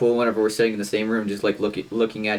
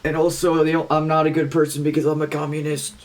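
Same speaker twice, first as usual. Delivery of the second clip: higher, slower put-on Lennon voice; [name] voice; 5 semitones higher, slower than usual. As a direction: ham up a mock Lennon confession. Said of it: "pull a [name]".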